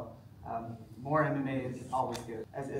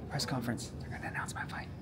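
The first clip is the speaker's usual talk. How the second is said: whispering. Real, not acted. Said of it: "Why are you whispering?".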